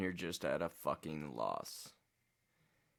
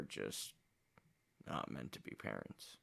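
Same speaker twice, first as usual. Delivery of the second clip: low, hushed and heavy; quiet sad; 8 semitones lower, 9 dB quieter than usual. Real, not acted. Reacting to his family's rejection, quiet sadness. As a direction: let it sink, barely audible.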